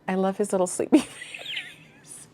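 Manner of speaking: slow fond whisper with a laugh. Real, not acted. To the sleepy cat in the cross stitch, a slow amused whisper. Cooing over the cat picture.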